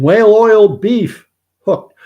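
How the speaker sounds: high, loud, drawn-out call; loud exclaimed name. Real, not acted. Reading a viewer's punning name aloud, loud and drawn out. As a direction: belt it out slowly and high.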